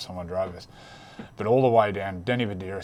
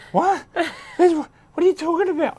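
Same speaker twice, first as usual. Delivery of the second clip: very high, loud flustered shriek; panicked squeal; 9 dB louder than usual. Retold or imitated. Bedsy freaking out about smelling of liquor, squealing very high. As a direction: shriek it like a mate who's been caught out.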